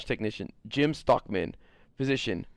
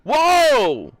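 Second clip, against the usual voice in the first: loud whoa; 12 dB louder than usual. Real, not acted. Reacting loudly in a game.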